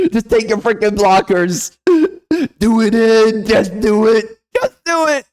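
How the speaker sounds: ranting yell. Real, not acted. Ranting at online users.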